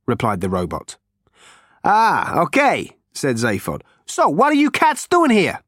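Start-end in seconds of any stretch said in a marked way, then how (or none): 1.8-3.0 higher, brash Zaphod voice. A narrator's reading. 4.1-5.6 higher, brash Zaphod voice. A narrator's reading.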